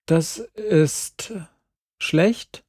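"Das ist … schlecht?" high, halting, questioning; tentative guess. A narrator's reading. Friend guesses at what love means, tentative "schlecht?". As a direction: pause before "schlecht", then lift it as a guess. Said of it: "riet ich".

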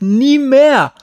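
NIE MEHR screamed high and loud; full-voice, agonised demand; desperate yell. A narrator's reading. Boy refuses ever going back there, desperate yell. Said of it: "brüllte Glenn zurück".

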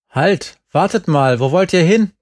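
put-on an old man calls loudly after departing kids; calling out. A narrator's reading. Old father calls the kids back across the yard, loudly. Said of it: "als wir ihn rufen hörten".

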